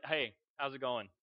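low, offhand greeting; casual, understated hello to a celebrity; playing it cool. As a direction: act unimpressed, keep it low-key. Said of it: "I'm gonna play it cool".

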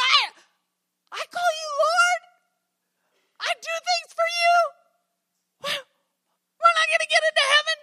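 put-on child pleading with God, scared; anxious pleading. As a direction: beg God for an answer.

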